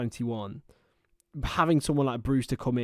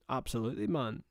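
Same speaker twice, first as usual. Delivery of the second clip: quick attempt at a Scottish accent; half-hearted Scottish accent; 4 dB quieter than usual. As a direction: throw in a casual Scottish accent. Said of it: "can I do a Scottish accent".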